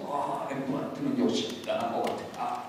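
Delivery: low, soft mock admiration; pretend refinement. Gallery visitor showing off before a Rembrandt, affected hushed awe. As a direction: murmur as if deeply moved by fine art. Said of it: "고상한 척 하려고".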